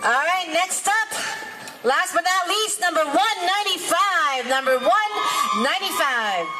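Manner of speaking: hype announcer. Real, not acted. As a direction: hype up the crowd, calling the next dancer. MC calls last dancer at battle.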